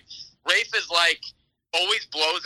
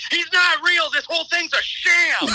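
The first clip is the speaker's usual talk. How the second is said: high, loud mock yell in church; 11 semitones higher, 3 dB louder than usual. Friend yells at wedding guests in church, high mock yell. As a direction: shout it out to the whole church. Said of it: "then you yelled that to everyone".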